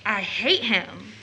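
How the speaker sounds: low, slow and soft, each word weighed; quiet hatred. Acted. Daughter admits to her mother she hates a boy, quietly and slowly. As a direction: say it under her breath with slow venom. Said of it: "you do use such violent language".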